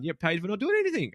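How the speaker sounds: high, loud and incredulous; amazed disbelief. Retold or imitated. New boss baffled by paid leave, high incredulous outburst. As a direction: sound scandalised that anyone gets paid. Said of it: "I was like".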